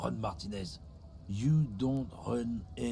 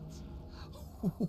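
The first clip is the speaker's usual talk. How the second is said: high laugh; 11 semitones higher, 6 dB quieter than usual. Acted. Character laughs at the threat.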